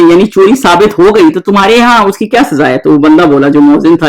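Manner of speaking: normal talking voice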